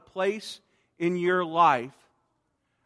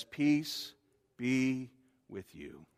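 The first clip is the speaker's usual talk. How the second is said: tender; 4 semitones lower, 8 dB quieter, much slower than usual. Acted. Jesus speaking to grieving hearts.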